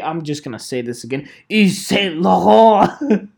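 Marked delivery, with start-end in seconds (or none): 1.5-3.3 relishing the name. Pretend. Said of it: "it's fun to say".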